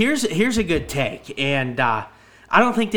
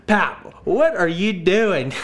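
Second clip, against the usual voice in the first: drawn-out, higher mock exasperation; exasperated retold question; 5 semitones higher, much slower than usual. Grandkid to Pap blowing the honker backwards, exasperated. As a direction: stretch it out slowly, imitating a baffled grandkid. Teller acts out scolding his grandfather.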